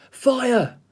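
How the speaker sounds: sudden high cry of alarm; frightened shout. Acted. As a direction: yelp it out, startled by the flames. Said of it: "Said Scarecrow".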